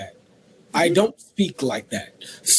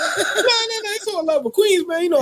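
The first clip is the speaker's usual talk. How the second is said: laughing; 12 semitones higher than usual. Real, not acted.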